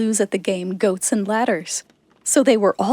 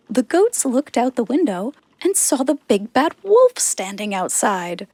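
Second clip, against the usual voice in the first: ordinary delivery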